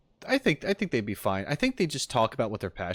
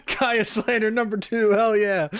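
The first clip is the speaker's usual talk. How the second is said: high, gleeful laughing cheer; real laughter; 9 semitones higher, 5 dB louder than usual. Real, not acted. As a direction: crow it loudly through laughter. Laughing at jab at Kaya.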